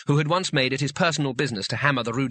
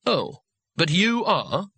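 slow, higher, sweetly polite; exaggerated politeness; 6 semitones higher, much slower than usual. A narrator's reading. Rincewind needles the trapped wizard, very politely. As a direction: draw it out with a grin. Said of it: "as politely as possible".

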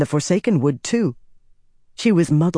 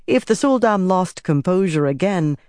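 usual speaking voice

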